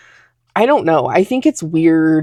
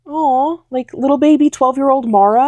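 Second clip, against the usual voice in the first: cooing; 7 semitones higher than usual. Real, not acted. Cooing over her younger self.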